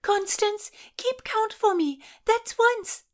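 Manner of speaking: high, loud running call; yelled. A narrator's reading. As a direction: shout it out while running. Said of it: "yelled Christina".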